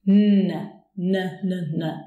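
"N" held loud, then short repeated n's; front-tongue N. Shown as a demonstration. Teacher showing when you say N, front-tongue n. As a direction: sound N with the tongue at the front of the mouth. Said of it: "with the tongue in the front of your mouth".

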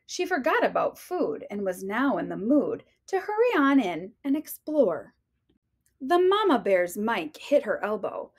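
ordinary delivery

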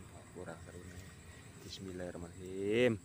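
usual speaking voice

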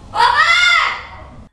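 sudden high, loud yelp; sudden shout. Real, not acted. Daughter startles her mother with a sudden loud yelp. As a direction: jolt out a loud, high "Opa!".

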